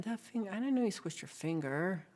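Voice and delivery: ordinary talk, nothing marked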